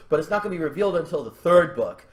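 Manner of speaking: ordinary talk, nothing marked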